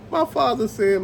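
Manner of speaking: usual speaking voice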